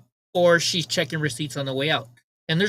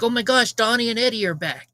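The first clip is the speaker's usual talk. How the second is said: higher, imitated delighted greeting; excited greeting; 6 semitones higher than usual. Young worker spots her favorite regulars, imitated excited greeting. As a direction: act out a worker thrilled to see regulars.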